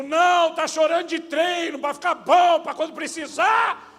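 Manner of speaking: high, loud and slow sarcastic yell; furious dad's sarcastic shout; imagined shout. Retold or imitated. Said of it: "Eu quero gritar pra Deus".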